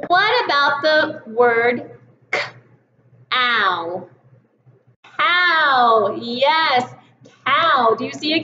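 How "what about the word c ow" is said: slow c-ow split onset and rime; segmented sounds. Shown as a demonstration. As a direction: stretch out c then ow. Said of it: "blend that word back together".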